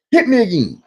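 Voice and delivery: normal talking voice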